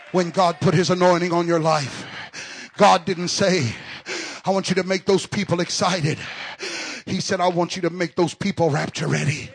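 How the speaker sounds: loud preaching climbing into a high shout; full-force preaching. Real, not acted. Preacher urging the men to back their pastor, full-force preaching.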